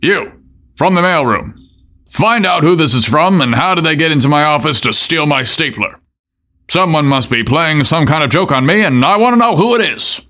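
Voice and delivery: angry boss. Acted. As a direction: bark it out, exasperated and climbing. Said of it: "as he barked".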